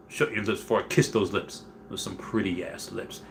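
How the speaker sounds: soft, quiet teasing taunt; low taunt. Retold or imitated. Sergeant taunting the security girl, quiet and cocky. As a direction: murmur it like a cocky come-on.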